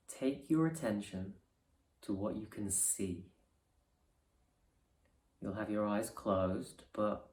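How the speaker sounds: soft, unhurried meditation voice with long pauses; guided meditation. Acted. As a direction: ease them gently into resting awareness.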